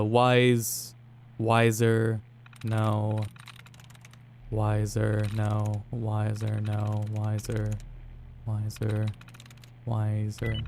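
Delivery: low, quiet absent-minded muttering; muttering to oneself. Real, not acted. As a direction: think aloud under your breath, low and soft. Muttering to self while playing game.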